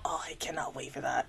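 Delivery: eager little whisper to herself; hushed, barely containing excitement. Real, not acted. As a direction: breathe it out, too excited to say it aloud. Excited aside under her breath.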